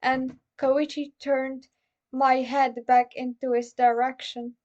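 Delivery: usual speaking voice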